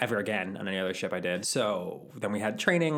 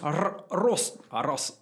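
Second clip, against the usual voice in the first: put-on Scottish accent; 7 semitones higher, much slower than usual. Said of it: "had a thick Scottish accent".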